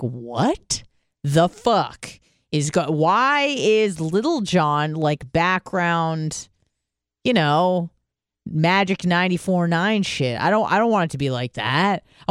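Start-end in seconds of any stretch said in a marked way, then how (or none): 0.0-10.4 drawn-out, slowed mock outrage; slow disbelief. Host hearing [name] in Walgreens, slow outrage. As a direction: stretch each word in disbelief. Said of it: "I'm like".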